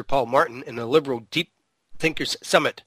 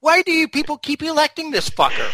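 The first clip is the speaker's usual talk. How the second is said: exasperated; 12 semitones higher than usual. Real, not acted. Exasperated rant at voters.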